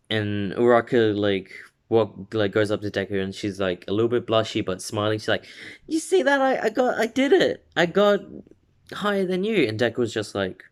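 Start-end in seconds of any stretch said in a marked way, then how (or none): 5.9-9.7 high, bouncy bragging; excited girl voice. Retold or imitated. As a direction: gloat with cheerful glee. Uraraka boasting to Deku.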